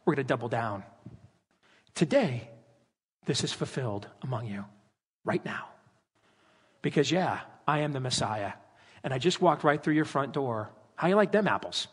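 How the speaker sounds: calm, bold challenge under the breath; hushed confident dare; low defiant Jesus. Retold or imitated. Jesus doubles down, voiced.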